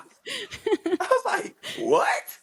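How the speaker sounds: very high, slow laughing what; laughing disbelief. Retold or imitated. Fighter mocking his own shock, high laughing what.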